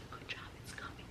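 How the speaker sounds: soft whispered encouragement. Retold or imitated. Assistant encouraging the pushing mother, whispered. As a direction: breathe it out, barely voiced.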